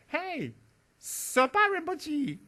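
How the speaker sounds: high, drawn-out shout across the yard; long high yell of a name; child's shout. Retold or imitated. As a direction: call his name out like an excited little boy. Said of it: "he screamed my name".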